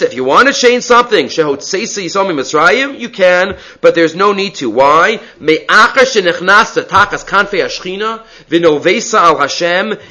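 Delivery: ordinary delivery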